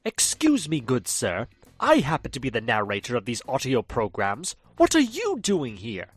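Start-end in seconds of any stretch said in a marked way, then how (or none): none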